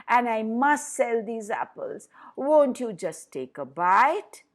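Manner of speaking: a witch playing a tired old fruit seller, tempting the girl; disguised old witch. A narrator's reading. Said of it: "disguised herself as a poor old fruit seller".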